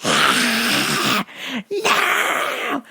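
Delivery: mock toddler screech; imitated child screaming. Child refusing yogurt from mother, imitated screaming. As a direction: screech like a child refusing, pitch jumping wildly. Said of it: "she starts screaming".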